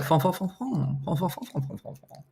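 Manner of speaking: a hint of mock French nonsense, fading away; mock French babble. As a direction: imitate French gibberish, trailing off. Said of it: "she was like".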